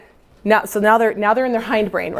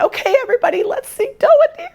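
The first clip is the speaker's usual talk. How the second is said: strained voice cracking up high; a teacher with a worn-out throat; croaky high voice; faster than usual. Retold or imitated. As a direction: push a failing voice much higher. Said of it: "he went".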